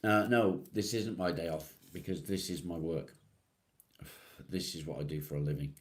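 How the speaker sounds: ordinary talk, nothing marked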